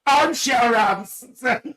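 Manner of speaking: high, loud, slow name call with a laugh in it; loud laughing call of a nickname; laughing shout. Real, not acted. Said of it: "I can stop shouting".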